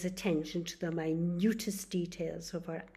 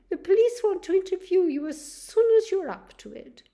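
much higher, louder sorry nurse voice; nurse breaking bad news; 12 semitones higher, 14 dB louder than usual. Nurse tells Charlie his wife died, higher and apologetic.

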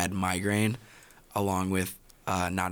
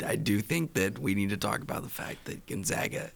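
fading hoarse voice; 4 dB quieter than usual. Real, not acted.

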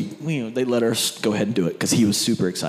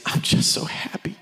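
tearful whisper; 8 semitones higher than usual. Retold or imitated. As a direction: breathe it out like a child overcome with joy. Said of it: "And he goes".

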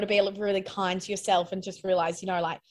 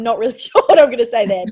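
laughing; 10 dB louder, faster than usual. Real, not acted. Laughs while admitting uncertainty.

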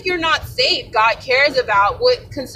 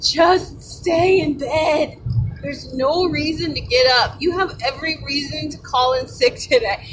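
tempting flesh voice; 2 semitones higher than usual. Retold or imitated. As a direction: coax yourself to stay in bed. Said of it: "when your flesh is saying".

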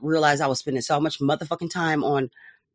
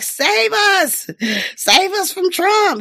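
mock pleading; 13 semitones higher, much slower than usual. Mocking voters begging Biden.

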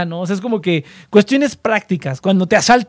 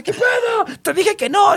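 high, put-on voice of a friend caught out; imitating the friend's shocked protest; upset friend; 12 semitones higher, slower than usual. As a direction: protest like a betrayed buddy.

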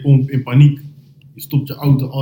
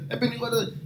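high, quieter mock of broken Dutch; 9 semitones higher, 12 dB quieter than usual. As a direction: imitate the annoyed delivery guy in broken Dutch. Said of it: "de meest gebroken taal". (hoog, zacht nagedaan gebroken Nederlands)

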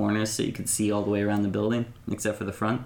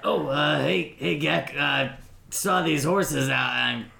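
nervous Geck; 5 semitones higher, slower than usual. Acted.

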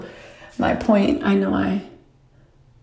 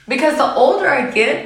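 ordinary delivery

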